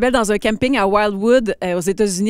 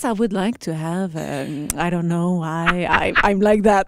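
low, hesitant English with a fading filler; lower English voice; 5 semitones lower, 3 dB quieter, slower than usual. Shown as a demonstration. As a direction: show how your voice drops in English. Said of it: "c'est ça".

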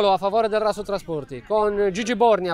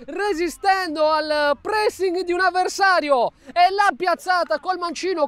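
breathless italian goal commentary; 7 semitones higher than usual. Real, not acted. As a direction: shout the goal from the top of your voice. Goal scored.

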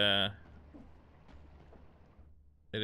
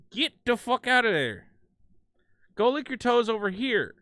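loud, raised-pitch telling-off; exasperated scolding; 9 semitones higher, 9 dB louder than usual. Real, not acted. Shooing the cat away from the game, loud scolding. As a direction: holler at a misbehaving pet.

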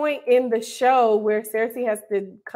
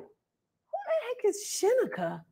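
higher, much softer puzzled wonder; puzzled aside; 11 semitones higher, 9 dB quieter than usual. Retold or imitated. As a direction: mutter it confused to yourself. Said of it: "And I was like".

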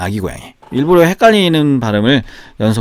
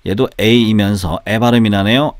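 ordinary talk

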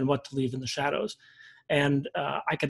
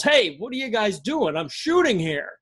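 mock yell; 7 semitones higher than usual. Photographer calling to two rangers in the dark, mock yell. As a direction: imitate a guy calling out indignantly. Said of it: "And our guy yells".

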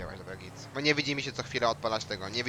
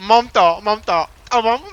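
loud; 9 semitones higher, 15 dB louder, slower than usual. Real, not acted. Shouting at game partner.

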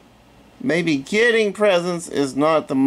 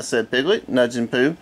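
ordinary delivery

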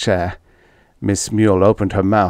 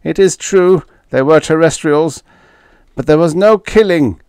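high alien voice, mildly weak; 8 semitones higher, 7 dB louder than usual. A narrator's reading. Fifth confessing they were terrestrials, weakly. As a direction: admit it weakly, up high in pitch. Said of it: "Fifth said weakly".

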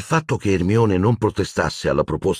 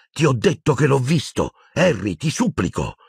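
desperate plea; 7 semitones higher than usual. A narrator's reading. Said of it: "esclamò disperata Hermione".

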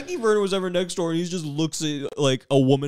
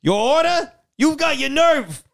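much higher, louder indignant wife; May voice; 6 semitones higher, 4 dB louder than usual. Retold or imitated. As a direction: scold him in a high huffy wife voice. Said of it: "this is my voice for May".

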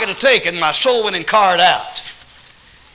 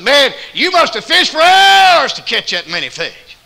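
high, loud, slow astonishment; amazed shout; 9 semitones higher, 8 dB louder than usual. Retold or imitated. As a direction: shout in wonder at the catch. Stranger amazed at catch.

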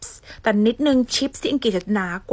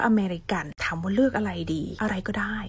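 whispered aside; 3 dB quieter than usual. Acted. Teacher confiding to viewers in a whisper. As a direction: confide it in a hush. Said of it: "unvoiced whisper".

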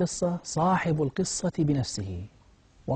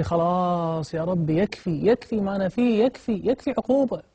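desperate pleading; 6 semitones higher than usual. Acted. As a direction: beg for it to stop, each repeat more insistent. يناجي ربه مستغيثا من تتابع العقوبة. (توسل يائس متصاعد)

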